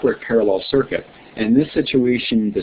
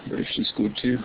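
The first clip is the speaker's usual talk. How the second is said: put-on British accent; 8 dB quieter than usual. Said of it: "those are my accents for the day".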